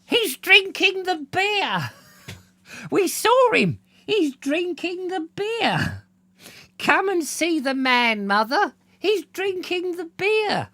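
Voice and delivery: put-on very high, slow excited cries of small girls; little girls' cries. A narrator's reading. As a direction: call out like tiny tattling sisters. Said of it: "loud cries of".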